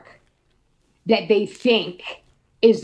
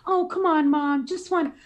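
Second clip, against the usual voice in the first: put-on higher, whiny kid begging; pleading boy voice; 7 semitones higher than usual. Little Alex begging his mother for one more swim, higher and whiny. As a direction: beg like a little boy, pitched up.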